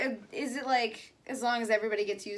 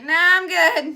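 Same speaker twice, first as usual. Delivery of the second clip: dismissive; 6 semitones higher, 14 dB louder, much slower than usual. Retold or imitated. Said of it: "He was just like".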